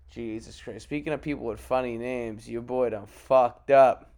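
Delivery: ordinary delivery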